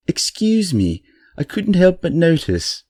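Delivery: a badly faked British accent, overly polite; mock British accent; terrible British accent. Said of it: "that is a terrible British accent".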